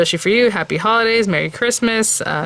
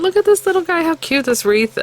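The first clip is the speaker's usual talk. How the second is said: squealing over something cute; 11 semitones higher than usual. Real, not acted. Gushing over a tiny stamp.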